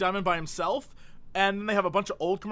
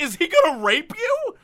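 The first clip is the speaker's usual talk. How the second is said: ordinary delivery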